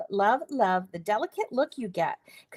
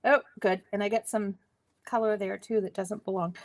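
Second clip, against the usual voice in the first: ordinary talk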